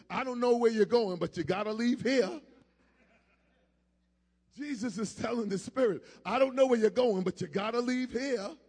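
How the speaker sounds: loud preacher ordering out a spirit; commanding preaching. Real, not acted.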